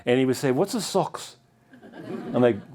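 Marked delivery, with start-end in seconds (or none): 0.5-1.4 lightly imitated hard German accent, higher and softer. Foreign runner asking about the signs, mock German accent. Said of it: "like, a German accent or something".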